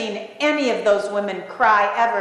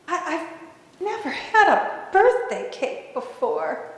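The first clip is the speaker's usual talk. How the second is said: halting, high-pitched plea with long pauses; acting overwhelmed, begging small and hesitant; faltering, tearful-sounding request; timid pleading; 7 semitones higher than usual. Tearful Agnes timidly begging, acted.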